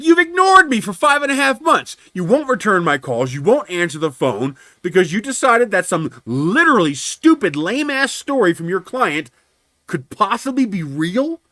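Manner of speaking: high, loud, indignant tirade; retold furious rant. Driver berating adjuster over months of being ignored, furious. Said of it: "I was pissed off to no end".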